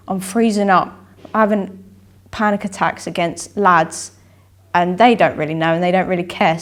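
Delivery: ordinary talk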